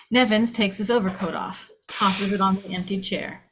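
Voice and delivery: ordinary delivery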